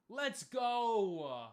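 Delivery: loud, high celebratory yell; triumphant shout. Real, not acted. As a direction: cheer loudly for the successful catch. Celebrating a catch.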